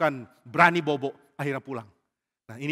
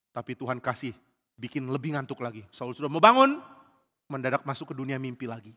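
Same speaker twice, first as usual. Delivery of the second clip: ordinary delivery, nothing marked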